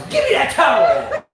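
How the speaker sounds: high, loud grab; mock demanding yell. Host replaying the player's towel grab, mock demanding yell.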